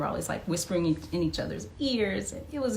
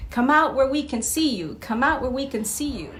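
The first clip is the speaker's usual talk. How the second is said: lightly voiced townsperson call; 6 semitones higher, 4 dB louder than usual. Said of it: "we're supposed to say".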